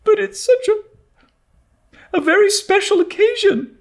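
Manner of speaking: put-on a girl close to crying; mournful young Anne; on the verge of tears. A narrator's reading. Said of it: "mourned Anne on the verge of tears".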